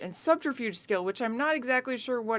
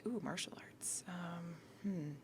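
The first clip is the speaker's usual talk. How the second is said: whispering; 3 semitones lower, 16 dB quieter than usual. Real, not acted. Excited over martial arts skill.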